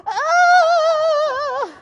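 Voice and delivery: high, loud, drawn-out wail; terrified wail. Retold or imitated. Running from the barking dog, a long, high, loud wail. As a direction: wail in mock panic.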